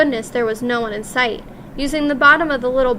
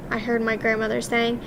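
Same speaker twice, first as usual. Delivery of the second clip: ordinary delivery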